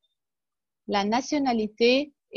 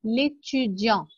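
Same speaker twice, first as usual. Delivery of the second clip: slow model word; much slower than usual. Shown as a demonstration. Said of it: "teacher models word slowly".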